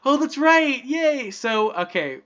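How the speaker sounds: normal talking voice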